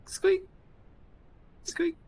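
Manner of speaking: high squeaky rat voice; rat squeaks. Acted. Rat squeaking in kitchen improv.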